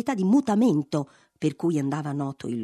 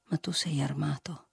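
pained; 3 semitones lower, 6 dB quieter than usual. A narrator's reading. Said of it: "con una pena che fece male al conte".

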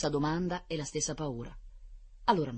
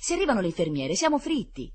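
raised, anxious impatient plea; imploring; 8 semitones higher, 5 dB louder than usual. A narrator's reading. Children fearing the nurses will catch them, imploring. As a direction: warn urgently, pleading for haste. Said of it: "imploravano i bambini impazienti".

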